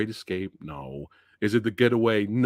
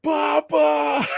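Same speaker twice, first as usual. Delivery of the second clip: high, loud mock yell of Papa; imitating a villain's shrill cry of Papa; 5 dB louder than usual. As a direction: belt out Papa like a desperate villain. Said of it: "He keeps yelling".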